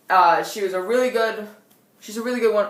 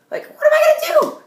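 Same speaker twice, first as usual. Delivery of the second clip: very high, loud squeak of helplessness; squealed mock despair; 9 dB louder than usual. Teen with only bathroom items for weapons, squealed mock despair.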